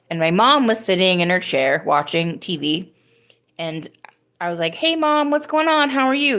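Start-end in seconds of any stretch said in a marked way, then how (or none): 4.8-6.4 bright high greeting, much higher; sing-song hello to mom; greeting mom with a lifted voice; cheery hello. Retold or imitated.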